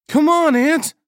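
high, loud, desperate shout; piercingly high panicked call; urgent yell. A narrator's reading. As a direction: yell to drag someone away from danger. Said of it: "Nagel yelled".

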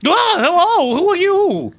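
high nervous little gnome; squeaky flustered inventor. Acted. Gnome Beavis speaks.

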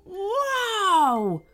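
high, drawn-out cry to halt horses; cry of whoa. A narrator's reading. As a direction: call "whoa" falling from a high pitch. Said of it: "with a cry of".

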